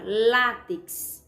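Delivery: "látex" with LA loud and lengthened; loud, held LA in "látex"; stress on LA. Shown as a demonstration. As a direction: lean hard on the first syllable of "látex". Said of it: "Lá recebe acento".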